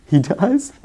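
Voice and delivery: plain reading voice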